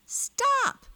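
very high, loud scream; piercing high cry from a captured girl; girl's scream. A narrator's reading. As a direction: scream it out in alarm. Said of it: "screamed the girl".

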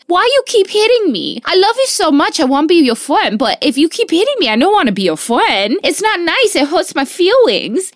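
put-on high little-boy voice, hurt and pleading; toddler voice. Mother imitating her toddler son begging his sister, high pleading. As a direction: plead like a hurt three-year-old. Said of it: "He goes".

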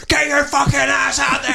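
high furious shout; yelling. Acted. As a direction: scream the order at them. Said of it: "I'm the yelling on phone guy".

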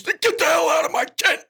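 rough, strained dismissal at a shout; Alan Graff. Acted. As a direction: throw the intruder out in a chewed-nails bellow. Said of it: "And he goes".

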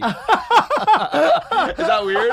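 high, loud laugh into the question; confessing the pee habit, laughing loudly; laughing hard. Real, not acted. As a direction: crack up while asking.

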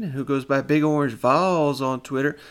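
ordinary talk, nothing marked

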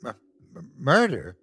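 halting, stuttered question; shocked stammer. Acted. Wife learns of her husband's crime, stammering in shock. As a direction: stumble on the word in disbelief.

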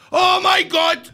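high, loud burst of astonishment; floored, blurting it out loudly; shocked exclamation. Real, not acted. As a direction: cry out in amazement. Startled at the guest's size.

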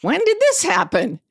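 startled high-pitched question to her son; retold surprise. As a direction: ask it like you can't believe your ears. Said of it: "And I was like".

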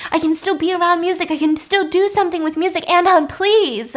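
high, urgent, insistent pleading; desperate begging. Retold or imitated. Lizzie begs not to be shut out.

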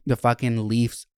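ordinary delivery

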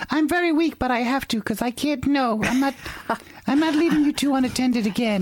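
high mock-weak wife voice. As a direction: squeak it out like a frail wife. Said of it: "She goes".

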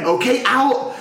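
high-pitched imitated command; mock loud order. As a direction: mimic an over-keen helper giving the cue. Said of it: "he was getting louder".

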